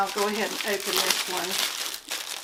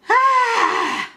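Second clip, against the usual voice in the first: loud, high exasperated huff; frustrated loud sigh-cry; exasperated outcry; 13 semitones higher, 7 dB louder than usual. Real, not acted. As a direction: let out a high, loud "hah!". Exasperated over wrong order.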